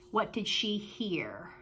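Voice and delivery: slow, hushed whisper; suspenseful whisper. Shown as a demonstration. Teacher whispering a question to the class.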